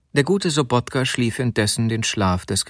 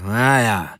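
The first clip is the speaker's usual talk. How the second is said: grudging, cool grumble; frosty grumble. A narrator's reading. Husband grudgingly answering his wife about dinner, frosty grumble. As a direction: grumble it off coolly. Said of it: "brummte er frostig und".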